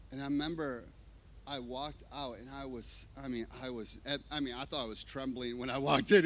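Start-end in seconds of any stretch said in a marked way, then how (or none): none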